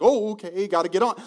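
mock excited. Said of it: "this excited".

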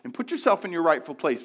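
loud, high, driving pulpit delivery; full-force preaching. Acted. Pastor urging the congregation to praise, in a loud preaching voice. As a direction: preach it out to the room with urgency.

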